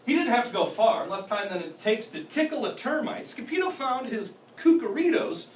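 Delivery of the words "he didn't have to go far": ordinary delivery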